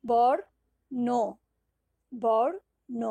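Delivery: বর then ন, said loud and apart; syllables apart. Shown as a demonstration. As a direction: split বর্ণ into বর and ন. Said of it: "কটি অক্ষর আছে?". (জোরে অক্ষর ভাগ করে বলা)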